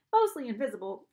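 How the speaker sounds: ordinary delivery, nothing marked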